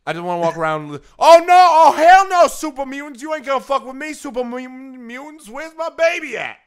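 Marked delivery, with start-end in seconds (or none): 1.2-6.6 very high, hammed-up outraged rant; sassy angry-mother caricature. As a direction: open very loud, keep it shrieky and indignant. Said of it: "particular type of voice".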